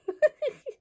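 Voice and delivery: short, high-pitched giggle. Real, not acted. Crocheter blanking on a word mid-lesson, high giggle. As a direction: giggle helplessly at your own slip. Laughs at own memory lapse.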